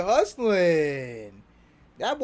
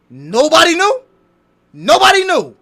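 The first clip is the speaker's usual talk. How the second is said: higher and louder, outraged surprise; loud disbelief; 5 semitones higher, 10 dB louder than usual. Real, not acted. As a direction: shout it in disbelief. Disbelief at the news.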